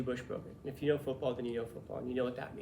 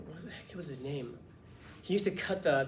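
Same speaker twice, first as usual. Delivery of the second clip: ordinary delivery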